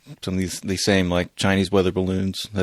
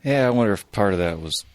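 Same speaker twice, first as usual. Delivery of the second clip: usual speaking voice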